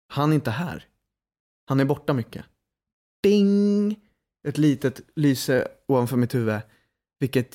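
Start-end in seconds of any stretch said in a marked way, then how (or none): 3.2-4.0 ding sound. Retold or imitated. A lightbulb idea sound.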